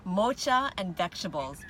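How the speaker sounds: Mocha as "MOH-chuh", vegetables as "vek-shuh-bulls"; Mocha and vegetables both said dad's wrong way; dad's mispronunciations. Retold or imitated. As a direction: mimic dad: "ch" in Mocha, "ksh" in vegetables. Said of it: "he says mocha".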